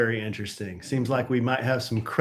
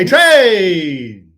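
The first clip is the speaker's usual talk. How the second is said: loud call-out; 7 semitones higher, 14 dB louder than usual. Acted.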